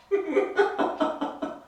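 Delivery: real laugh, high and airy; high burst of laughter. Real, not acted. Fan laughing at his own NASA joke, high and light.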